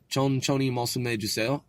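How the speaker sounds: "Chon choni mosume juseo" rising on "juseo"; questioning intonation. Shown as a demonstration. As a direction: lift the end of "juseo" as if asking. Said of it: "sounds like I'm asking a question".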